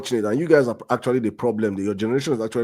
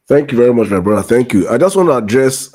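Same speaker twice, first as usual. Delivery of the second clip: much louder, booming thanks; 8 dB louder than usual. Real, not acted. Host loudly thanking his brother before addressing listener. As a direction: project it like over a crowd. Second speaker loudly on mic.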